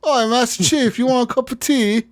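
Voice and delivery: high, hammed-up British accent, pitched well up; mock British accent. Cortana offering Master Chief tea, in a mock British accent. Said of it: "He has a British accent now".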